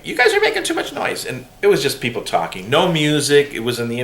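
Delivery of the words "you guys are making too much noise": complaining neighbor. Retold or imitated. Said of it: "she's like".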